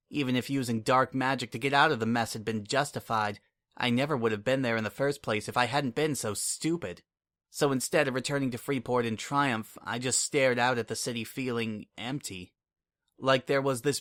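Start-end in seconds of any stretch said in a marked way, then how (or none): none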